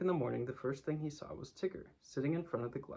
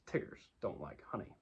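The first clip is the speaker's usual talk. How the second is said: lower, quieter, settled verdict; decided; 6 semitones lower, 5 dB quieter than usual. Acted. Tigger telling Pooh his firm verdict on honey. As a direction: state it as final, no arguing. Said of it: "in a very decided voice".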